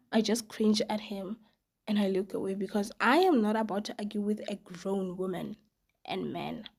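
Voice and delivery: plain reading voice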